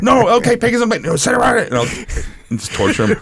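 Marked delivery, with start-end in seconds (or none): 0.0-1.7 put-on very high, loud child; kid voice. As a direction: play the son arguing. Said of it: "he'll be like".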